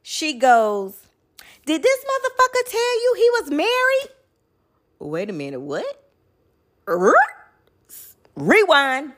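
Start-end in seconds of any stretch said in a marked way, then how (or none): 1.7-4.1 retold shrill yelling at the door, pitched way up; furious woman yelling, much higher; high angry yell. Wife at the door yelling at the man, high angry yell.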